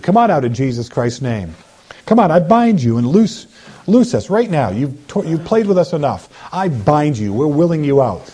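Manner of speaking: ordinary talk, nothing marked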